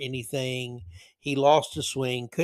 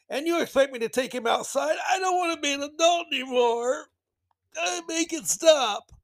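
much higher whiny shout, slowing at the end; whiny mock meltdown; 11 semitones higher than usual. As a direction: wail like a parent giving up.

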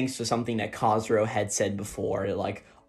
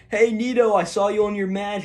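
high, louder acted big-brother hello; imitated proud brother calling out; excited greeting; 9 semitones higher, 4 dB louder than usual. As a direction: hail him over video chat, hammed up. Big brother hails Nito, retold.

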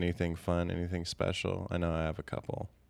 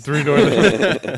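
loud laugh through the words; cracking up while repeating it; 8 semitones higher, 14 dB louder, much slower than usual. Real, not acted. Mocking Dexter's self-added title.

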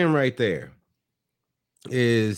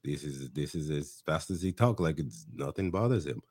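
much lower and softer, unhurried and unbothered; imitating Johan's laid-back talk; 8 semitones lower, 9 dB quieter than usual. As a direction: lightly do Johan, nothing fazes him. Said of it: "he's talking like this".

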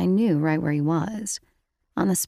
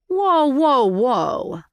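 high, loud holding-back call; loud whoa; 7 semitones higher, 5 dB louder, much slower than usual. A narrator's reading. Marco to Rachel, loudly calling a halt. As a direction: rein her in, loud and high.

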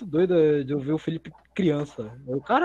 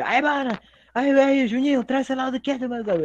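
put-on Rio favela accent; 7 semitones higher than usual. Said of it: "Tem um sotaque dos caras do morro".

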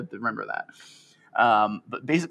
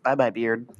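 ordinary delivery

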